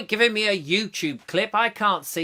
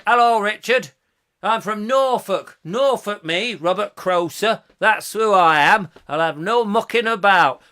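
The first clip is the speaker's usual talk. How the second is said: higher-pitched, blustering Norfolk; Norfolk accent; 3 semitones higher, 5 dB louder than usual. Shown as a demonstration. Robert from Norfolk having no mucking about, Norfolk accent. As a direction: protest in a hammy Norfolk accent. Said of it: "now I'm gonna do Norfolk accent".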